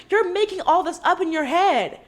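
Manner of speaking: put-on, high accusing voice; mock accusation. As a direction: imitate someone insisting the other imagined it. Acting out Heather's gaslighting accusation.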